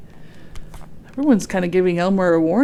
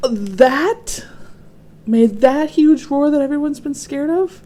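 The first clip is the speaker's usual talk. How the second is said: higher, louder astonishment; amazed; 7 semitones higher, 5 dB louder, slower than usual. A narrator's reading. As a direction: marvel at it in disbelief. Amazed at the roar.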